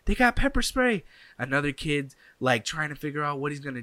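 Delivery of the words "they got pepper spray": high, loud panicked yell; retold scream. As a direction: scream the warning to everyone. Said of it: "I hear some kid screaming".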